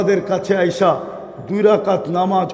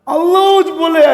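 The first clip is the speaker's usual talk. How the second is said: preaching exclamation; 8 semitones higher, 4 dB louder than usual. Acted.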